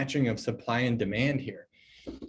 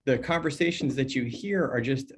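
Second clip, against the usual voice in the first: usual speaking voice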